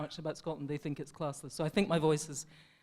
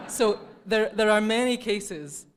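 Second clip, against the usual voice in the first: laughing, louder and higher; 5 semitones higher, 9 dB louder than usual. Real, not acted. Laughing aftermath, loud and higher.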